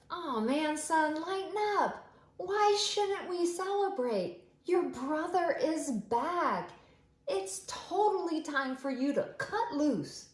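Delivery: high, bright coaxing dad voice; cheerful father. Acted. Father urging the older son to join, high and cheerful. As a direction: coax the sulky son into the fun.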